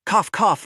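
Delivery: loud hammed-up fake cough. Faking a cough for the boss, hammed-up. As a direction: cough it out, badly faked. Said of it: "pinched my nose".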